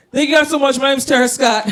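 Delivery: loud, high closing thank-you; loud bright sign-off. Real, not acted. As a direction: shout the goodbye to the room. Signing off over music.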